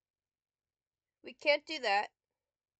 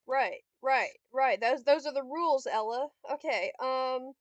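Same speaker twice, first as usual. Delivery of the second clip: ordinary talk, nothing marked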